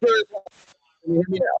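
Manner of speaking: muffled, breaking-up call audio; muffled wah wah talk. Real, not acted. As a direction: sound like the Peanuts teacher. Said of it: "You're very muffled".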